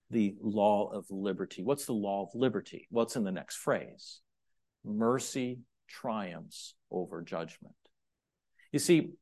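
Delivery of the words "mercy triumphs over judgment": slow, hushed reading of the verse; Mercy triumphs over judgment, said softly and slowly. Shown as a demonstration. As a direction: let it land quietly and deliberately. Sermon key verse, read softly.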